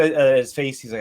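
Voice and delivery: ordinary delivery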